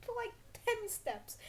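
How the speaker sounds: lightly voiced naive younger self; mock inner voice. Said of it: "I decided".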